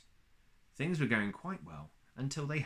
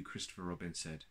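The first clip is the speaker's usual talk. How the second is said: ordinary delivery, nothing marked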